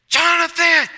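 high, loud shout of a name. Retold or imitated. Casey on the top bunk waking his roommate with a shout. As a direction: yell to wake him.

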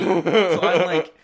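real laughter spilling over; laughing. Real, not acted. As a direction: caught in a fit of laughter. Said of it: "laughing really hard".

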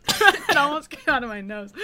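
ordinary talk

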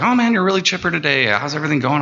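high, quick imitation of friendly bartenders; chirpy greeting. Bartenders greet their chipper coworker, high and chirpy. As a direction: lift the pitch for a retold upbeat greeting.

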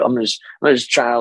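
ordinary talk

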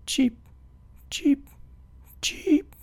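high little chirps, cheep cheep; bright baby-bird cheeps. A narrator's reading. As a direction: chirp each one like a small bird. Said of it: "He cheeped".

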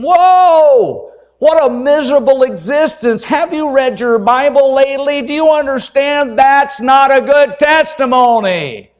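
high, loud, incredulous cry at the couple; a pastor scolding a bickering couple, loud and high; exasperated outburst. Retold or imitated. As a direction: throw up your hands in disbelief, loud and high. Pastor's inner outburst at yelling couple.

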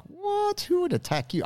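high incredulous squeak; disbelief. Retold or imitated. Friend hearing the arborist's story, shocked disbelief. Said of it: "And I was like".

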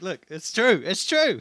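high, excited insistence; excited exclamation. Retold or imitated. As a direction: burst out with it, pitch jumping up. Mimes pointing excitedly at the lyrics.

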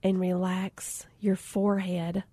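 slow soothing whisper; zen whisper. Retold or imitated. Instructor guiding her through relaxation, slow hushed whisper. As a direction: breathe the words out like a meditation guide. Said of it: "She's like".

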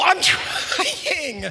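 high, drawn-out whiny protest; mock frustrated wail. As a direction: imitate a kid wailing back, stretching the word. Replaying the girl's wailed reply.

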